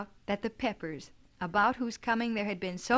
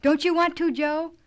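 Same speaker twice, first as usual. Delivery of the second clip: high, loud eager question; can't keep still, blurt it out; much higher, loud and eager; bursting with excitement; 8 semitones higher, 9 dB louder than usual. A narrator's reading. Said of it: "unable to keep still any longer".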